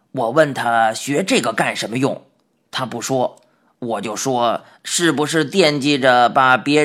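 put-on odd old man. Odd old man explains himself.

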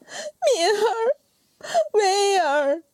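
very high, crying out their names; wailing sob. Acted. Mother crying over her dead children, high wailing sob. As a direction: wail for your lost children. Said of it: "放声大哭".

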